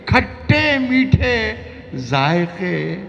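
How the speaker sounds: high, slow declaimed verse; sing-song the line to the crowd; ghazal recitation. Acted.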